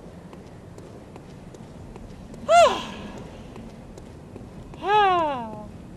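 long, breathy exhale; heavy sigh. Real, not acted. Player after the boss fight, heavy sigh.

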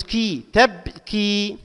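very slowly stretch "كي" in "تبكي"; drawn-out long vowel. Shown as a demonstration. Teacher analysing the word for students, drawn-out long vowel. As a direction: hold the long i ending "تبكي". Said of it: "مد مع الممدود".

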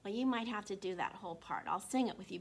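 ordinary delivery

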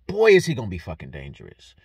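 ordinary talk, nothing marked

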